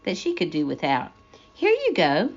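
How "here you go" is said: put-on friendly girl voice. Ruthie hands over hooks.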